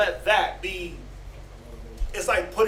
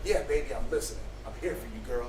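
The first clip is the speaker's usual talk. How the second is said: put-on soft low sweet talk to his new girl; tender cooing; 9 semitones lower, 8 dB quieter, faster than usual. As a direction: lower and much softer, a mock lover's murmur. New boyfriend cooing to girlfriend.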